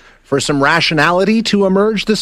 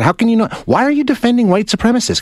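higher-pitched, indignant demand; exasperated challenge; 7 semitones higher than usual. Real, not acted. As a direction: challenge the caller in real exasperation. Heated argument with caller.

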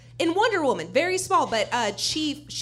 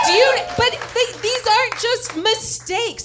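heated rant; 7 semitones higher, 6 dB louder than usual. Real, not acted. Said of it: "I'm very heated".

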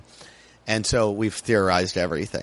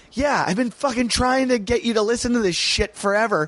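exasperated; 11 semitones higher than usual. Retold or imitated. Exasperated retold reply to wife.

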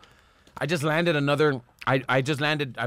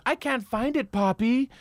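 high, pleading-style put-on woman's lines; imitating scene partner; 8 semitones higher, slower than usual. Actress calling back to her partner from the trunk, imitated high voice. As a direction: mimic her in a raised pitch. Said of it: "and she goes".

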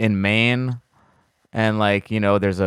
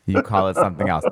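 ordinary talk, nothing marked

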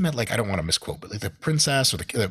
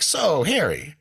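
high, bright mock greeting from a chatty dinner guest; chummy guest; 11 semitones higher, slower than usual. A Dubai dinner guest cheerfully greets Prince Harry in a high mock voice. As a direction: ham up a too-friendly stranger.

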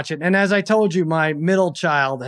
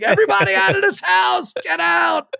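mock angry yell; 13 semitones higher than usual. Dad ordering the kids out, in a high mock yell. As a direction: throw everyone out in fake fury. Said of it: "And I'm like".

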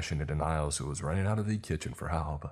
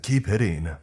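loud, raised urgent command; yell; 4 semitones higher, 8 dB louder than usual. A narrator's reading. Manager mid-fight to the crew, yelling. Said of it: "I yelled".

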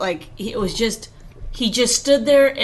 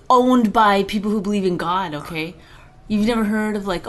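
ordinary talk, nothing marked

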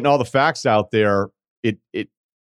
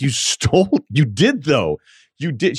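accusing; 7 semitones higher, slower than usual. Retold or imitated. Friends confronting the thief.

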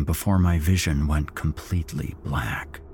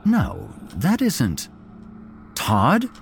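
slow, halting disbelief; dawning horrified surprise; stunned recognition; 7 semitones higher, slower than usual. A narrator's reading. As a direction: realize who it is, shocked.

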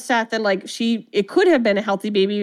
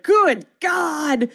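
shocked outburst; 6 semitones higher than usual. Retold or imitated. Stathis reacting to the tape, a shocked high shout. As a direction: recoil from the footage in horror.

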